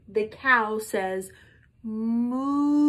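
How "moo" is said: a sung-out moo; cow moo. Shown as a demonstration. Teacher leading children in a cow moo, mooing. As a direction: moo it out for the kids to copy. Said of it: "Can you say that with me?".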